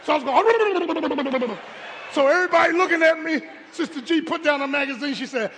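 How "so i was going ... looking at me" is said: loud, stretched preaching climax; preacher hollering the punchline; preaching shout. Real, not acted. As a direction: raise it to a shout as the story peaks. Climax of drowning story.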